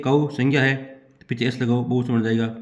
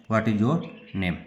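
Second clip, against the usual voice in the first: slow dictation; 3 semitones lower, 4 dB quieter, much slower than usual. Shown as a demonstration. Teacher dictates the finished question.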